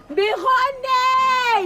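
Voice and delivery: slow, drawn-out high wail. Acted. Wife wailing to friend about husband, sobbing. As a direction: stretch the word into a lament.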